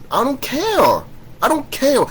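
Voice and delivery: very high-pitched, impatient retort; exasperated dismissal. Real, not acted. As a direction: throw it back with pitch way up. Dismissing the imagined objection.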